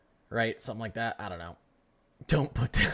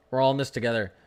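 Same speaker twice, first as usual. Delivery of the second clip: normal talking voice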